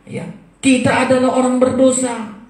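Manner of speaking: usual speaking voice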